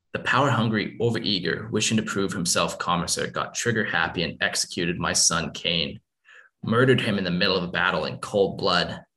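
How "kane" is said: ordinary delivery, nothing marked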